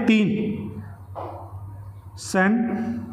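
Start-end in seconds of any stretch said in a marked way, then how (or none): 2.2-3.0 sentence very slowly, long gaps between sen, ten, s; split into syllables. Shown as a demonstration. Teacher counting sounds for students, sentence split sen-ten-s. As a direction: isolate the three sounds of sentence. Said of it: "देखिए कितनी sound बनी".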